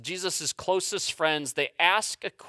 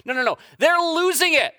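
ordinary delivery

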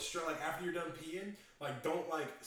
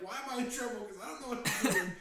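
put-on confused kid; 7 semitones higher, 5 dB louder, faster than usual. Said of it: "So he's confused".

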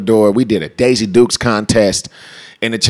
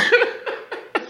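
high breathy laugh; 7 dB quieter than usual. Real, not acted. Laughs after the quote.

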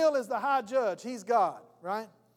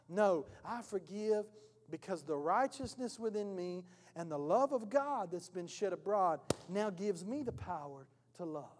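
usual speaking voice

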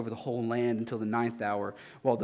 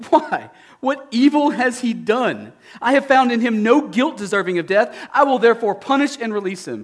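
Pilate, declaring; 10 semitones higher, 8 dB louder than usual. Acted. Pilate asks a third time.

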